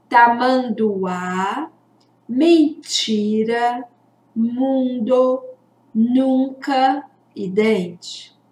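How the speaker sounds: slow, careful reading of each word; slow word list. Shown as a demonstration. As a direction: read the list slowly for the children. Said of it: "Vamos descobrir o que é?".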